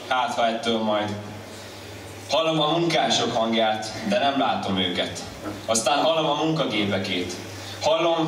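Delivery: ordinary talk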